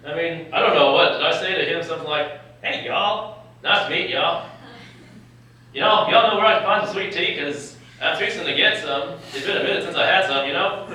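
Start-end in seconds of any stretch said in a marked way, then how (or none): 2.6-10.8 joking Southern twang, teasing the resident; hammed-up Southern accent. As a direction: play up a fake Southern accent for laughs. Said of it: "do you have a Southern accent?".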